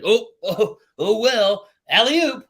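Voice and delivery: high, bright mock "oh, oh, alley-oop"; hammed-up high voice tossing the puck back; mock cheery bystander. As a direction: chirp it up high like a goofy passerby. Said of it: "And he's like".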